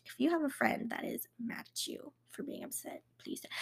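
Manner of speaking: whispered aside to the viewer. Real, not acted. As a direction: drop to a confiding whisper. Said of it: "unvoiced aside".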